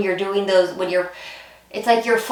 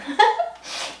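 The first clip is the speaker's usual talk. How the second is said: bright high laugh; a quick giggle at yourself; 13 semitones higher than usual. Real, not acted. Laughs at own lisp worry.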